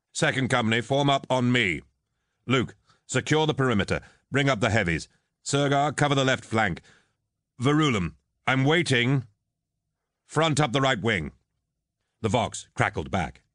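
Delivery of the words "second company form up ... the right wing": higher, firm military commands; commanding orders. Acted. Captain rallying his company over the vox, commanding. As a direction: issue crisp orders to the company.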